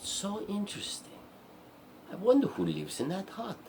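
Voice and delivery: high, wondering princess voice; intrigued storybook princess, high; curious princess. Acted. Princess wonders about a hut.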